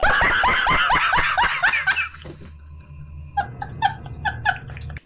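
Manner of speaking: high shrieking laugh; big laugh. Real, not acted. Host cracking up at the reunion drama, shrieking laugh. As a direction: burst out laughing.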